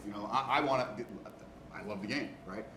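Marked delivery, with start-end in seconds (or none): none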